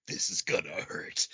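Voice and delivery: hushed whisper, bracing for pain; whispered dread. Acted. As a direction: whisper it like a private warning. Acts out character's dread before hit.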